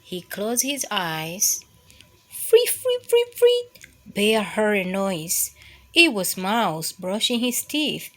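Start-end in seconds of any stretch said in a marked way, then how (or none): 2.3-3.7 tiny squeaky whistle; high whistled free free. Acted. Mouse whistling in bed, high squeaky whistle.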